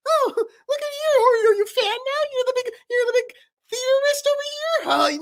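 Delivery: very high mocking teasing; Paper's voice. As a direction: tease in a squeaky sneer. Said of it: "Paper and Knife talking".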